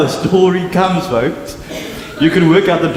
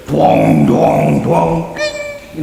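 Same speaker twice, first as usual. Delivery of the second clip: coin clangs; much slower than usual. Retold or imitated. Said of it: "you can hear the jingle".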